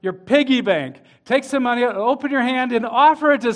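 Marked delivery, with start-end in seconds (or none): none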